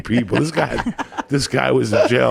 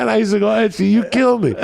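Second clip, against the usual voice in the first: delighted laugh-talk; 11 semitones higher, 4 dB louder than usual. Retold or imitated. Laughing at Etchy.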